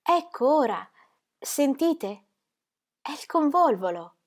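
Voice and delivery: she's caught the scent and wants him to notice; Ingeborg, delighted. Acted. (Ingeborg entusiasta, voce più acuta)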